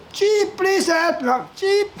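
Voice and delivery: very high, slow pleading chick voice; crying baby bird. Acted. Cold chick crying to anyone for help, very high and slow. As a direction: beg for help as a tiny freezing bird. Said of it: "I was crying".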